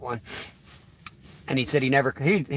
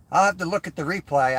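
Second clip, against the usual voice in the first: ordinary talk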